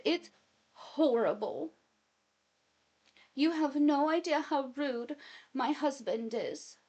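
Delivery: milk it like a wronged wife; overdramatic complaint. Retold or imitated. Wife whining to her mom about her husband, overdramatic.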